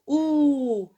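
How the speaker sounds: "ou" held long as a single oo; drawn-out ou. Shown as a demonstration. Teacher telling pupils how O with U is pronounced, long held ou. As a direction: stretch "ou" out, letting the pitch fall. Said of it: "elle se prononce".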